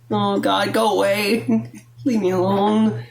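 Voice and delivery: half-laughing, put-on plea to be left alone; exasperated plea. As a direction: groan it out in mock exasperation. Acts out wanting grandpa gone.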